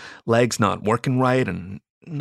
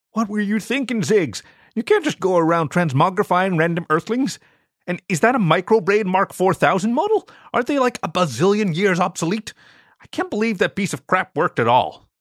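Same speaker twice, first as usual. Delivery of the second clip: higher, exasperated alien sibling; scolding alien Zorg; 8 semitones higher than usual. Acted. Zorg berates Ziggy for zapping earthlings, higher exasperated voice. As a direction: tell off a reckless little brother.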